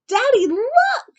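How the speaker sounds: put-on high, loud little-girl call; excited child shout. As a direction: shout proudly to daddy. Child calls to father.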